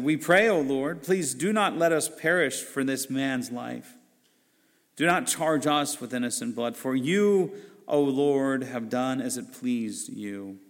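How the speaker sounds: ordinary delivery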